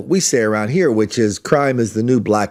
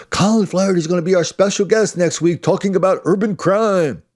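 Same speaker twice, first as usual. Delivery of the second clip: mock announcement; 7 semitones higher than usual. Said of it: "And he goes".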